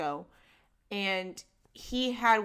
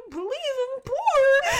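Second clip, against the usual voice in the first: laughing; 14 semitones higher, slower than usual. Real, not acted. Laughing at being thought poor.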